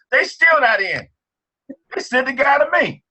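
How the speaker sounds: high, thrilled disbelief; excited. Real, not acted. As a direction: let the payoff burst out. Amazed punchline of son's story.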